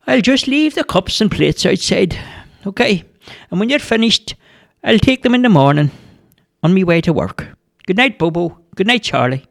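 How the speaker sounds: the kindly baker chatting as he leaves; cheery baker. A narrator's reading.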